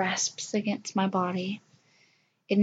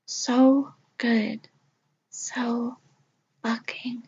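usual speaking voice

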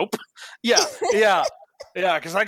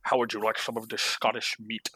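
Sean Connery impression; 7 semitones lower, 6 dB quieter than usual. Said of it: "don't let me do a Sean Connery impression".

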